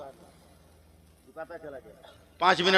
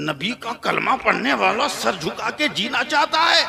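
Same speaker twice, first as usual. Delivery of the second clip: put-on defiant boy; 6 semitones higher, 7 dB louder than usual. Boy defies village elders.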